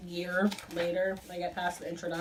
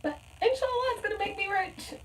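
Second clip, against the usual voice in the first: mock hopeful squeak; 3 dB louder than usual. Vlogger jokes the book will make her rich, high squeak. Joking hope of getting rich.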